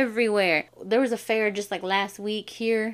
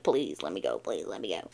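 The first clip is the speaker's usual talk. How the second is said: much lower, much softer begging; pleading whimper; 14 semitones lower, 8 dB quieter than usual. Retold or imitated. Child begging strict mama, low and soft. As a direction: beg her quietly, small and pitiful.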